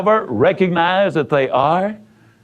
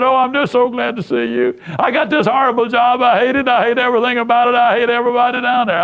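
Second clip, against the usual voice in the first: retold tearful griping, pitched up, rushing at the end; a hint of a distraught negative Nell pouring it out; near tears; 6 semitones higher, faster than usual. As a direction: voice the upset woman's complaint, higher and speeding up. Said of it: "She almost started crying when she saw me".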